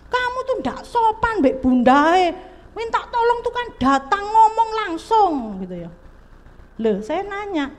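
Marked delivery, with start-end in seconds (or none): 0.1-5.9 mock scolding, pitched well up; scolding parent. Speaker mimicking a mother scolding her child, mock nagging. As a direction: play the nagging mother, high and bossy. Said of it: "apakah kita harus marah?".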